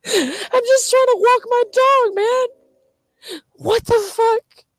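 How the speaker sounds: very high, drawn-out wail; crying. Real, not acted. Protesting being threatened while walking the dog, crying. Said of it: "'cause I'm crying".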